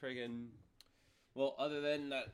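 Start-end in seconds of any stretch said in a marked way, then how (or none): none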